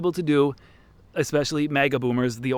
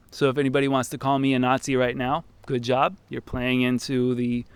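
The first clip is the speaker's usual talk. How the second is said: normal talking voice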